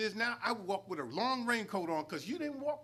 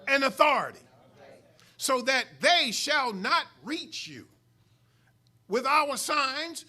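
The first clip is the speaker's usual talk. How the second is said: loud, slow, raised preacher's delivery; forceful scripture preaching; 5 semitones higher, 7 dB louder, slower than usual. Acted. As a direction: proclaim God's promise, building in force. Preaches scripture as God's words.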